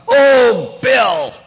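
high, shouted fake curse; hammed-up loud exclamation; mock oath. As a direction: belt the name out like a swear word. Mock oath with a substitute name.

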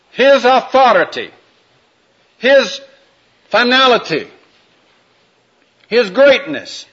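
preaching. Acted. Preacher addressing the congregation about Jesus Christ, in emphatic preaching. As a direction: proclaim each word to the congregation.

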